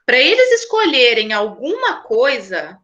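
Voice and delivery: ordinary delivery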